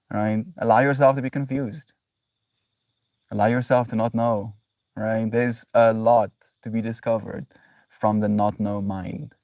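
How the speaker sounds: usual speaking voice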